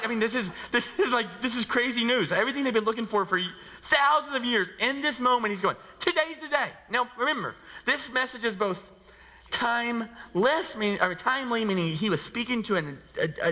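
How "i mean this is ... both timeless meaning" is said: excited. Real, not acted. Excited preaching.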